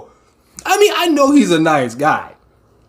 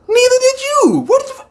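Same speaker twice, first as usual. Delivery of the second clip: high, startled exclamation; a sudden high-pitched gasp of disbelief; shocked outburst. Real, not acted. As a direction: caught off guard, blurting at the screen. Shocked reaction to the show.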